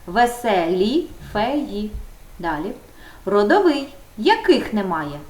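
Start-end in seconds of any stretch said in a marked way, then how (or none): 0.1-1.9 drawn-out, each syllable spelled out for pupils; slow model answer. Shown as a demonstration. Teacher to pupils, slow dictation voice. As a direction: read it out slowly like a teacher modelling. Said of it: "Які?".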